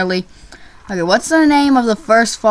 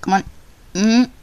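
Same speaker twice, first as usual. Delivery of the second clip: usual speaking voice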